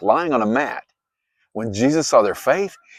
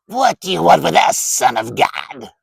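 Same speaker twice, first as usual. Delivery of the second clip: loud, higher, otherworldly demon voice; demon shout; 4 semitones higher, 6 dB louder than usual. A narrator's reading. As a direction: snarl it at the Son of God. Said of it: "they shouted".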